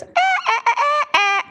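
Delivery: high squeaky toy noises; Furby squeaking. Retold or imitated. Speaker mimics the Furby squeaking at Siri, high squeaks. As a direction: squeak like a broken talking toy. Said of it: "And the Furby goes".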